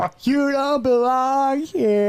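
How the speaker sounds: loud, slow, high yell; yelling at a tree. Pretend. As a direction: scold the tree loudly. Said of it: "yell at trees like so".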